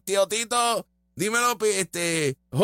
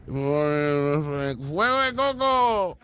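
slow, drawn-out botched chant; mimicking her slogan; 2 semitones lower, much slower than usual. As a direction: stretch the garbled cheer out slowly. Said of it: "Eso fue lo más gracioso".

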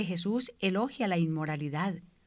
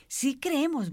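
high-pitched, restrained mock cry; retold crowd cry; 6 semitones higher, slower than usual. Onlookers answer the man with the wheelbarrow, restrained high cry. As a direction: pitch it up like a crowd, without shouting. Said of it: "volvieron a gritar".

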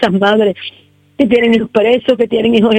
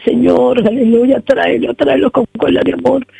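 raised, pleading prayer voice, building in intensity; fervent prayer; 5 semitones higher than usual. Real, not acted. Grandmother praying aloud for her family and the youth, fervent pleading. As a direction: cry out to God for the sick and the youth.

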